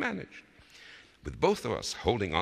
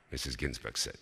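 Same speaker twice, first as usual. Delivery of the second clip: plain reading voice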